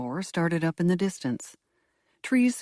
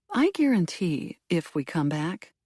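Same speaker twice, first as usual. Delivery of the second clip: plain reading voice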